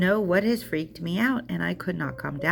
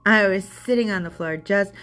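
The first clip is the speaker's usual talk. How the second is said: ordinary talk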